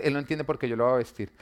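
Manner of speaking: ordinary talk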